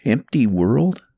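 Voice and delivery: plain reading voice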